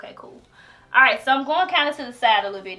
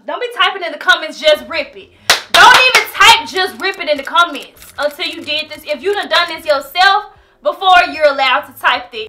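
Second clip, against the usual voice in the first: loud, raised-voice telling-off; emphatic scolding; 3 semitones higher, 11 dB louder than usual. Real, not acted. Vlogger warning commenters off advice, emphatic scolding. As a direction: scold the commenters, punching it loudly.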